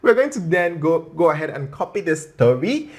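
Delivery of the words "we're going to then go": ordinary delivery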